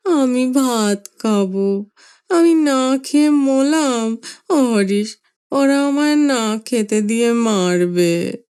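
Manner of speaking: put-on slow, whining old man in tears; helpless weepy plea; childish crying. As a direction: grandfather sobbing like a child. Grandfather weeps for food.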